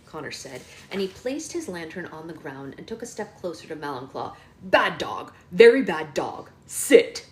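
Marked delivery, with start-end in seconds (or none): 4.6-7.2 loud, punched dog commands; forceful acted telling-off; stern scolding. Boy scolds wolves like dogs.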